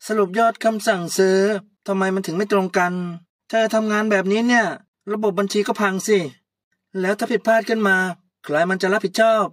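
angry boss. Acted. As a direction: rant at her like an outraged boss.

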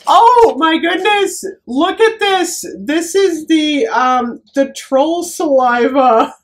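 high, drawn-out delight; thrilled. Real, not acted. Vlogger showing viewers the next gift, thrilled. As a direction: gush it up high, amazed at the gift.

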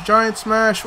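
ordinary talk, nothing marked

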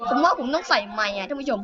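ordinary talk

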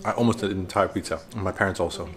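low, soft murmur; keeping voice down. Real, not acted. Telling viewers about dinner while the baby sleeps, keeping voice down. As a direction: talk softly so a sleeper isn't woken. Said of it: "so I cannot talk too loud because Jason is also sleeping".

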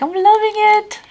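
high, sing-song slogan; jingle line. Pretend. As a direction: chirp it like the ad jingle. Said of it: "And I'm just like".

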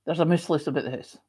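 quick Scottish "there's a moose loose about the house", moose said "moos"; Scottish accent demo. Shown as a demonstration. Showing Nana Paula the Scottish phrase, quick Scottish accent. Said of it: "There you go. See? That's how it works".

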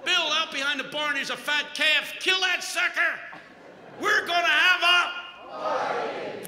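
loud, high joyful orders, last line slowed; jubilant shouting. Retold or imitated. Overjoyed father ordering the fatted calf killed, jubilant shout. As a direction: shout the party news with delight.

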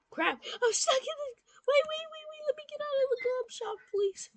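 high, loud, frantic begging; panicked pleading. Real, not acted. Kid trapped in the game shop, high and loud frantic begging. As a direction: beg the game to let you out.

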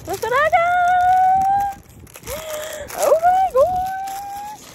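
long, drawn-out high squeal; excited squeal. Real, not acted. Showing viewers the new keyboard, excited squeal. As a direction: squeal it loud and high, stretching the words.

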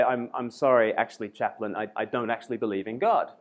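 hesitant, low and apologetic; shy student. Retold or imitated. Shy student owning up to the chaplain, sheepish and low. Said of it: "would be very, uh, shy".

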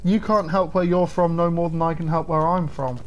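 ordinary talk, nothing marked

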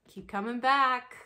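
hammed-up group chant of the slogan. Mock meeting slogan.

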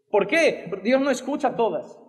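usual speaking voice

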